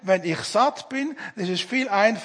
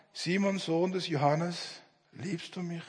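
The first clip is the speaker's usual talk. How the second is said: softer and lower, fading almost to a breath; tender question; 3 semitones lower, 8 dB quieter than usual. Acted. Jesus quietly asking Peter if he loves him, hushed and tender. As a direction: ask it gently, barely aloud.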